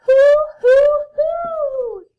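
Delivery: very high, loud drawn-out hoots; owl hooting. A narrator's reading. Owl revealing itself to the friends, loud hooting. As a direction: hoot it out like an owl. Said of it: "an owl says".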